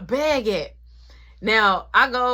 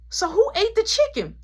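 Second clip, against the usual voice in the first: high, louder demanding question; angry demand; 14 semitones higher, 5 dB louder than usual. Retold or imitated. Uncle demands who ate his chicken, high and angry. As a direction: demand an answer, heated.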